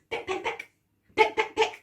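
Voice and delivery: crisp breathy peck peck peck; pecking sounds. Shown as a demonstration. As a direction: tap it out like a bird pecking. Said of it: "peck with your heads really fast".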